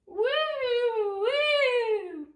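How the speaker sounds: high straining whoop while hanging on; effortful woo. Real, not acted. Hanging off the railing, straining whoop. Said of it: "That's my show of strength for the day".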